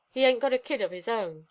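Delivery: plain reading voice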